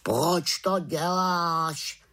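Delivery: slow, drawn-out raven question. Acted. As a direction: ask it slowly as a puzzled raven.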